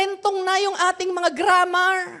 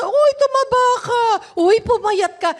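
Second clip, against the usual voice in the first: lightly acted busybody, higher and louder; nosy remark; 5 semitones higher, 5 dB louder than usual. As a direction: call out a teasing remark. Voices people's remarks on weight. (usiserong pamumuna)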